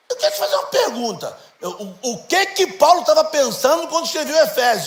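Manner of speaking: put-on high, eager kid's question; child's voice. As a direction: play the curious little son. Voicing his young son's question. (voz de criança curiosa, bem mais aguda)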